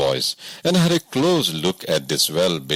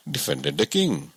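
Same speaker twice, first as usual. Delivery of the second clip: ordinary delivery, nothing marked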